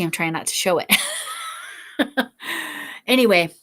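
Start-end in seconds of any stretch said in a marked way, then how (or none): 1.0-3.0 airy, higher-pitched laugh. Real, not acted. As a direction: laugh at yourself after a stifled yawn. Laughing after stifling a yawn.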